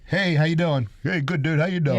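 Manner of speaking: lightly mimicked, high-pitched casual hello. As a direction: toss off an upbeat, not-quite-accurate celebrity greeting. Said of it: "it didn't quite have that accent".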